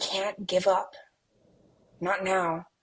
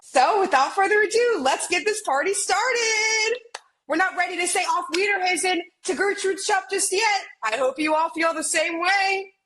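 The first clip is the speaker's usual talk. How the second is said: high, shouting hype to the crowd; excited host; 8 semitones higher than usual. Acted. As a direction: kick off the party at full pitch. Host hyping fashion show crowd.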